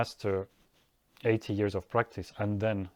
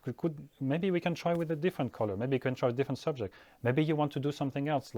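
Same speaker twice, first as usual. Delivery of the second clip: fast, gentle coaxing, starting higher; coaxing a crying child; 4 semitones higher, 3 dB quieter, faster than usual. Retold or imitated. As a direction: quickly offer the child options.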